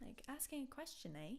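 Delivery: a hint of Canadian tag question; mock Canadian eh. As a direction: tease with a Canadian upturned eh. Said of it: "Isn't that Canadian".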